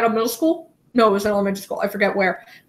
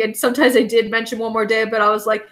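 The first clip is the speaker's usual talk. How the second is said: usual speaking voice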